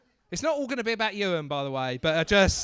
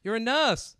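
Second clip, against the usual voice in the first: ordinary talk, nothing marked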